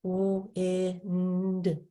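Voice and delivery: stretched wind; very slow stretched. Shown as a demonstration. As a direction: drag out "wind" very slowly. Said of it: "So we have wind".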